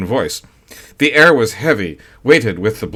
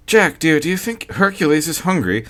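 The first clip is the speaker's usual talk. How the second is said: put-on higher, anxious young wife's voice; the young wife asks her husband, higher and anxious; young wife Sylvia; 7 semitones higher than usual. A narrator's reading. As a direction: voice her worried and clinging. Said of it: "Sylvia's voice held in dialogue".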